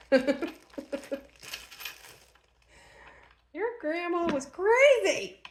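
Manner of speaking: lightly voiced grandchild, slow and high; future grandchild's voice. Imagined grandchildren talking about her.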